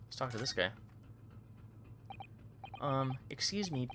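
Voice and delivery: normal talking voice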